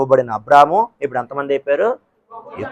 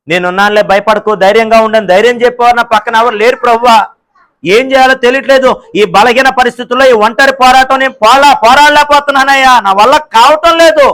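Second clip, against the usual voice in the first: building from plaintive to a higher, louder cry; desperate plea; 6 semitones higher, 5 dB louder than usual. Acted. Lonely believer crying out to God, rising desperate plea. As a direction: let the despair swell into a cry.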